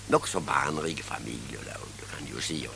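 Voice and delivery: breathy whispered aside, no voice; sly whispered joke to the audience. Acted. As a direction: drop to a confidential whisper. Whispered aside about big families.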